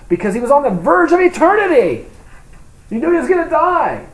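high and loud, pressing the point; a rising preacher's cry; preaching at full force. Real, not acted. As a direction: drive it home with fervour. Preacher peaks on eternity.

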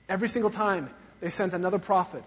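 usual speaking voice

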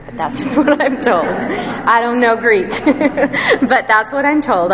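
self-deprecating laugh breaking through the words. Real, not acted. Preacher admits he doesn't know Greek, laughing. Joking about his lack of Greek.

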